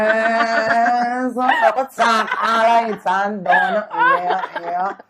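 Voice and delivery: laughing through the words; laughing as she remembers. Real, not acted. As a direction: break into real laughter mid-story. Laughing while telling story.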